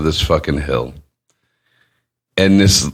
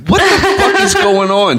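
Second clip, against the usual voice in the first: high-pitched, incredulous outburst; outraged bewilderment; 12 semitones higher than usual. Retold or imitated. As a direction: demand an explanation, voice shooting up.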